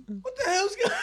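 high, hushed mock astonishment; retold disbelief. Friend startled by the phone answering, high retold disbelief. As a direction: squeak it out high and soft, baffled. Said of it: "And he goes".

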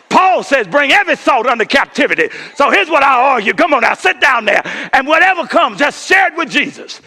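high, ringing sermon voice; full-force preaching. Acted. Pastor to his church, full-force preaching. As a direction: preach it to the rafters.

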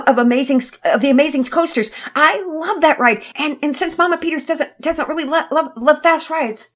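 put-on high, loud girl's voice tripping over words; a little girl too thrilled to breathe; high girl voice, stumbling with excitement; eager girl. Penelope's child voice, rushing.

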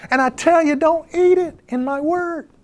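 high-pitched voice of God giving a stern command; God speaking. Retold or imitated. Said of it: "God comes along and says".

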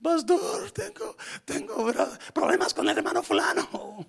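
high put-on caller voice; worried caller. Pastor imitates a member calling about a problem. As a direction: play a church member calling with trouble. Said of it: "pienso que es una mala noticia".

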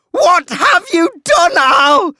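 high, furious, panicked screaming; shrieking, slow and emphatic; terrified scream. A narrator's reading. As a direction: scream the accusation at full force. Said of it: "and screams".

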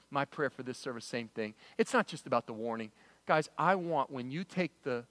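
low, soft, earnest appeal; heartfelt. Real, not acted. Pastor telling congregation his dream for the service, heartfelt. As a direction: drop down and share it tenderly.

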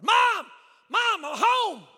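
high, very loud acted holler; calling out. Boy searching the garage for his mom, loud holler. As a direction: yell for Mom, stretching the words slowly.

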